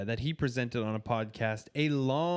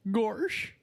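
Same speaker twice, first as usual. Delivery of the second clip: high goofy cartoon "Gosh"; hammed-up Goofy "Gosh"; Goofy impression; 10 semitones higher than usual. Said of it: "goofy Disney impression".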